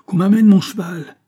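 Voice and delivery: loud, high-pitched command; urgent shout. A narrator's reading. Farmer ordering his horse brought at once, urgent shout. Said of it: "criait le fermier".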